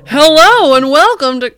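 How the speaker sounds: loud, high announcer blast; joking over-the-top show opener; hammed-up booming welcome; mock big intro. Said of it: "I'm just kidding".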